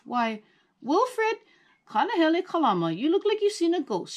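high, quick, amused grandma voice; chuckling grandmother. A narrator's reading. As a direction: tease him fondly like a grandmother. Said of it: "she chuckled".

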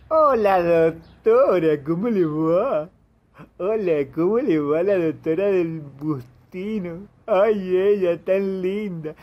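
slow, syrupy flattering voice; sweet-talking. Acted. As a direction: draw it out to charm her. Caller sweet-talks the doctor.

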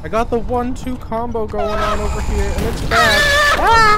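loud, high panicked yelling; panicking gamer. Real, not acted. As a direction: shout as the attack lands. Under attack, yelling.

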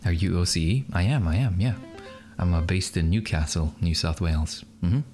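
usual speaking voice

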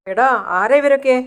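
high-pitched, urgent questioning; urgent demand. A narrator's reading. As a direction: step into the fight and demand answers. Brother intervenes in acted street fight.

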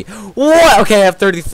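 loud, high yelp of disbelief; shocked outburst. Real, not acted. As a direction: burst out in amazement. Shock at viewer count.

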